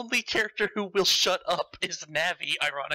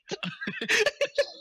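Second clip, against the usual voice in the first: laugh. Real, not acted. Far high laugh.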